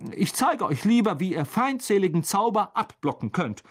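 high-pitched, flustered teacher. A narrator's reading. Teacher to pupils on blocking hostile spells, flustered. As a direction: sound thrown but keep teaching. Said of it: "sagte Lockhart verwirrt".

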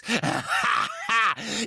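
high, manic cackle; mad laughter. A narrator's reading. Harry laughing madly at Bellatrix. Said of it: "lachte so wahnsinnig wie zuvor Bellatrix".

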